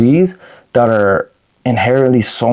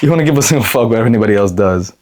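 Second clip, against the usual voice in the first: normal talking voice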